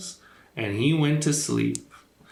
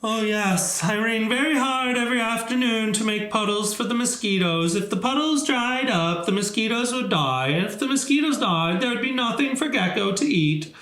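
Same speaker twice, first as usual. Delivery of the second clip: higher, fast, eager explaining voice; high Rain voice; 9 semitones higher than usual. Acted. Rain explains to Elephant why it rains, high and fast. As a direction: rattle it off high and quick.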